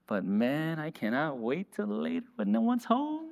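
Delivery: a hint of a guilty hush; sneaky aside. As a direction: say the hidden plan under your breath. Secret aside after mock confession.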